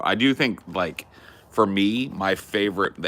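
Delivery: ordinary delivery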